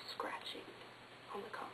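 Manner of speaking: barely audible spooky whisper; ghostly whisper. A narrator's reading. Storyteller ends the scary tale to the listeners, whispered. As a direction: breathe the last words like a ghost.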